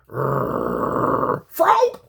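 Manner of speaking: growling into a sudden shout; growl then yelp. Retold or imitated. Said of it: "he'd growl".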